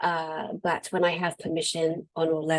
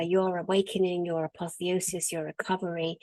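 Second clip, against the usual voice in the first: ordinary delivery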